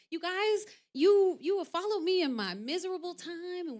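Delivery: high, bubbly, excited announcement; retold happy gushing. Actress replaying how she shared her happiness online, excitedly.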